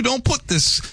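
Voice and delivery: high put-on Long Island voice; half-hearted Long Island twang; mock Long Island accent. Cohost joking on air, teasing in a bad Long Island accent. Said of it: "I can't do a Long Island accent".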